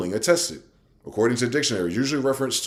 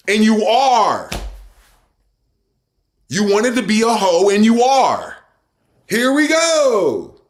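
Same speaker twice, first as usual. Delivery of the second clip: high, loud, angry yelling at listeners; shouting rant; 8 semitones higher, 5 dB louder than usual. Real, not acted. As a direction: bellow it as the rant peaks.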